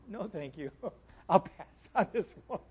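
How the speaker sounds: softly voiced, half-laughing refusal; meek refusal. Jonah turns down God's invitation to go, meek refusal. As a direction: decline quietly, as if backing out.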